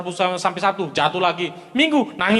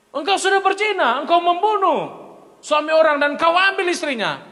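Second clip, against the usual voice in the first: high, raised rebuke as the prophet Nathan; prophet rebuking; 8 semitones higher than usual. Acted. Prophet Nathan rebukes King David, high and stern. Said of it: "Nabi Nathan tegur".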